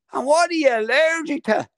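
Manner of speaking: high, jeering heckler question; mock heckler. Sideline heckler taunts the referee, high and jeering. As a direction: needle the ref from the sideline.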